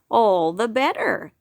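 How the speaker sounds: a lightly voiced wise old groundhog. The groundhog welcoming the whispering rabbit, lightly voiced. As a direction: put on a knowing old groundhog. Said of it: "said the groundhog".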